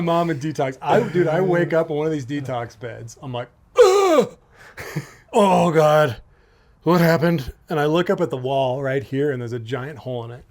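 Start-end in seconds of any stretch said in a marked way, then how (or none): 3.8-4.4 loud, high hungover groan; exaggerated morning-after "ugh"; acted waking groan. Acts waking up in detox. 5.3-7.5 loud groaned bewilderment; re-enacted morning-after confusion; acted hungover waking. Acts waking up in detox.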